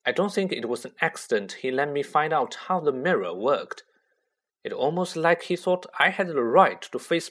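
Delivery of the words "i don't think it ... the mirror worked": plain reading voice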